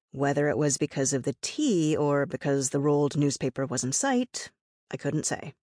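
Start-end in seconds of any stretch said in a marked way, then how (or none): none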